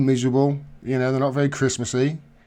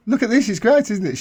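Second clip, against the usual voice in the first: excited; 8 semitones higher, 4 dB louder, faster than usual. Retold or imitated. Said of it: "I said to my girlfriend today".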